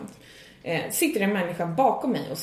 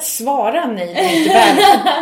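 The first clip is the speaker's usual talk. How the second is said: higher, louder, laughing exasperation; frustrated rant; 5 semitones higher, 8 dB louder than usual. Retold or imitated. To comedy clubs ignoring booking emails, a laughing frustrated rant. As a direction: vent it, half laughing. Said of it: "Jag blir så frustrerad".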